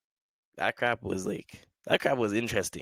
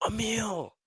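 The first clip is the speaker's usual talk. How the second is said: high, sudden call of a name across the street; shouted name; 12 semitones higher, 3 dB quieter than usual. Retold or imitated. Old elementary schoolmate calling out to him on the street, high shout. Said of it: "they scream out".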